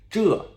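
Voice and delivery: 这 starting high and loud, falling sharply; fourth-tone 这. Shown as a demonstration. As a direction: punch 这 with a descending tone. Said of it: "fourth tone descending tone".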